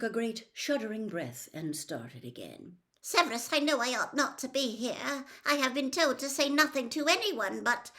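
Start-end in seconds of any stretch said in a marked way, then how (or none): 3.0-7.9 Narcissa's voice. A narrator's reading.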